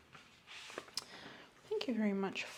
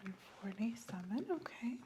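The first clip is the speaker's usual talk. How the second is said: soft whisper echoing the number; doctor whispering the reading back; whispered repeat; 6 dB quieter than usual. Acted.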